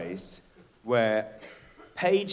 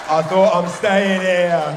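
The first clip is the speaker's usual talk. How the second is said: relieved shout; 4 semitones higher, 10 dB louder, slower than usual. Retold or imitated.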